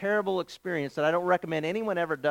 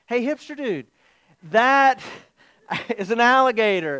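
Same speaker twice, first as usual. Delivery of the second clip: loud, raised-pitch mock alarm with a break after "that"; retold alarm; 6 semitones higher, 7 dB louder than usual. Tourist warning his guide about a gator, in mock alarm. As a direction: call out an urgent warning, hammed up for laughs.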